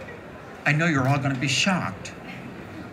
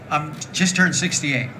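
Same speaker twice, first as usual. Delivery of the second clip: ordinary delivery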